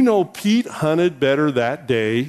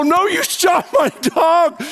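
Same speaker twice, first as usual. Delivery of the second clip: high, slow, indignant mock yell; retold fury; 12 semitones higher than usual. Pastor arguing back at the friend over his dog, acted fury. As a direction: throw the accusation back, drawn out and high. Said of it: "and I am furious".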